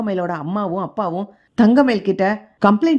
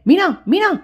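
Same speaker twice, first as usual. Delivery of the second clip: higher-pitched call of her name; urgent, raised call; 10 semitones higher, 4 dB louder than usual. A narrator's reading. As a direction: call out to get Meena's attention. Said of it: "அப்படின்னு கூப்பிடுறாரு".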